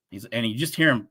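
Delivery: ordinary talk, nothing marked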